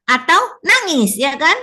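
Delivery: ordinary talk, nothing marked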